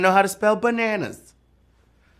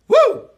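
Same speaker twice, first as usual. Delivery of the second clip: high, loud celebratory whoop; a big joyful woo; excited whoop; 6 dB louder than usual. Real, not acted. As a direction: whoop for the players. Host cheering again.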